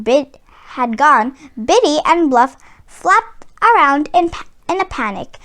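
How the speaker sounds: usual speaking voice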